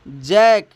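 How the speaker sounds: "जैक" said firmly as a model word; a teacher's clear model: "जैक"; pronouncing jack. Shown as a demonstration. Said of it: "इसकी उच्चारण होती है दोस्तों". (शिक्षक का साफ़ उच्चारण "जैक")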